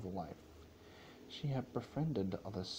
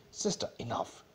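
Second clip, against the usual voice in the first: sharp, forceful rebuke; growled command; 3 semitones higher, 4 dB louder than usual. Acted. Luna scolding Celestia, a sharp growled command. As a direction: cut her sister off hard. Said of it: "demanded Luna, growling".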